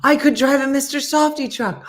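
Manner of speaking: high, loud burst of delight; excited outburst. Real, not acted. Thrilled at driving an ice cream truck, excited outburst. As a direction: blurt it out like a dream job.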